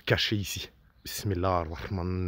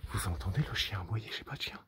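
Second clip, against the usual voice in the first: tense hushed whisper; 9 semitones higher, 9 dB louder than usual. Real, not acted. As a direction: whisper as if afraid. Frightened whispering at noises.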